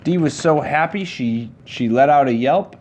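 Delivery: ordinary delivery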